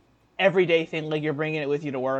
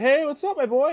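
cheerful dad imitation; 11 semitones higher, 6 dB louder than usual. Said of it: "He's like".

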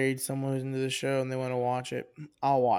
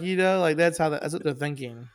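ordinary talk

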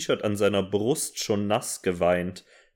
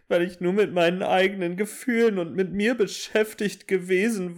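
mock tearful; 9 semitones higher than usual. Hammed-up self-reproach.

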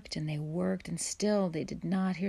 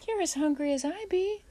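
put-on high, louder child's retort; insistent child; 13 semitones higher, 5 dB louder than usual. A narrator's reading. Boy to his sister who declines food, insistent. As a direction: insist in a raised boyish voice.

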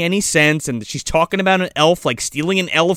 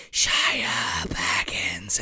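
hushed, drawn-out beckoning whisper; a forest calling her softly; spooky whisper; 12 semitones lower, 10 dB quieter, much slower than usual. Retold or imitated. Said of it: "it's just like".

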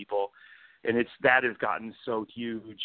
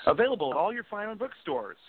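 higher mock ad announcer; mock ad tag; 9 semitones higher than usual. Author jokingly closing his blurb, mock ad. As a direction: tack on a cheesy ad line.

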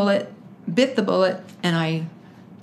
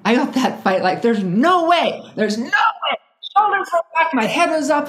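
louder, higher, full of fight; fired-up confidence; 6 semitones higher, 5 dB louder than usual. Real, not acted. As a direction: build from steady resolve to emphatic conviction. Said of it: "my change in voice right now".

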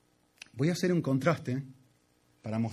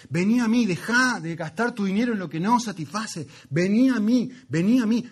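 rising, louder pleading call as God; impassioned preaching; 5 semitones higher, 4 dB louder than usual. Acted. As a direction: preach it at full force, swelling each repeat. Preacher voices God's call.

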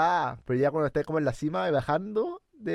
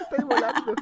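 laughing; 11 semitones higher than usual. Real, not acted. Laughing at friend sniffing alcohol.

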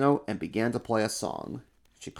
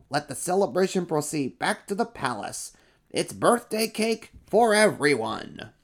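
higher royal proclamation; proclaiming emperor; 7 semitones higher than usual. Acted. Emperor decrees truth-telling to the crowd. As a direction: announce grandly to the kingdom.

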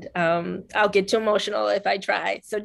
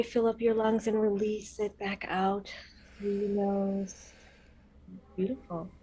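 guided breathing. Shown as a demonstration. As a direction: gently lead a calming breath. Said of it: "Will you lead us in a deep breath".

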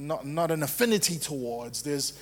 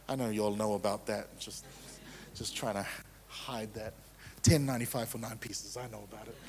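sheepish aside; 7 semitones lower, 8 dB quieter than usual. Real, not acted. Joking confession about cheap KFC.

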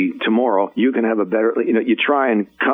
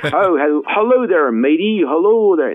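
mock English accent, much higher and louder; dad's bad English accent; 11 semitones higher, 5 dB louder than usual. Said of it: "horrible English accent".

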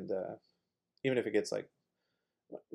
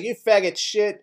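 higher, louder jeering; mock scream back; 8 semitones higher, 7 dB louder than usual. Older kids screaming back at him, louder jeering. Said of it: "they would all scream back like".

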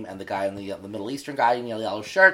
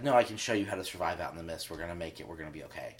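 soft, low, fading comfort; a father's hushed lie of comfort; hushed reassurance; 8 semitones lower, 11 dB quieter, much faster than usual. Retold or imitated. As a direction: soothe him quietly. Father soothing son before sending out.

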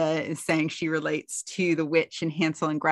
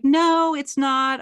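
high, louder, mock-innocent "no"; defensive denial; 9 semitones higher, 5 dB louder, much slower than usual. [name] to Charlotte about the baby name, high mock denial. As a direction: brush the accusation off in a put-on voice.

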